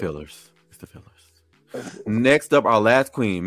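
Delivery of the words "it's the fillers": slow whispered aside; whispering. Real, not acted. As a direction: breathe it out as a secret. Repeats the remark, whispered aside.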